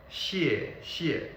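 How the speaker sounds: 谢谢 split into two separate, fully falling syllables; character-by-character pronunciation. Shown as a demonstration. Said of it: "when you pronounce each character".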